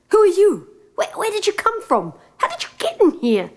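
high, startled questions; young prince startled awake. Acted. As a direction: caught off guard, demanding answers.

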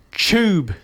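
"tube" as "chube", traditional New Zealand style; slow Kiwi "tube" starting with a ch sound; flat New Zealand accent. Shown as a demonstration. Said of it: "the traditional flat New Zealand accent".

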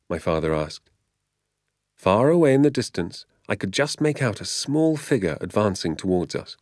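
usual speaking voice

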